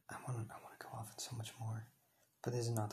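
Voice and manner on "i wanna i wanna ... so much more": fast, hushed aside whisper. Real, not acted. Telling listeners she holds back, whispering fast. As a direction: let it slip out under your breath.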